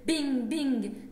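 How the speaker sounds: two ringing bings, each falling in pitch; loud imitated bing. Poet falling off the shelf, loud imitated impacts. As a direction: punch out the bings like bumps.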